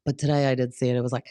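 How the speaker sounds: normal talking voice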